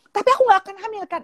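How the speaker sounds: fast, high imitated child's question; worried daughter. Daughter checks with mother about pregnancy, quick high mock worry. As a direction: blurt it like an anxious schoolgirl.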